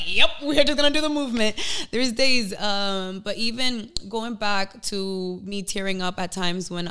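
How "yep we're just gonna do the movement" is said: fast, high, breezy self-talk; quick mock resolve. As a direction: brush it off quickly and brightly. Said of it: "I was like".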